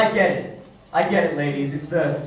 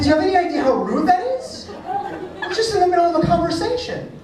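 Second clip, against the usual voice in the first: high-pitched mock indignation; comic offended protest in a high voice; indignant reproach; 10 semitones higher, faster than usual. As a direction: scold your date, pitched way up. Acted indignant reproach to his date.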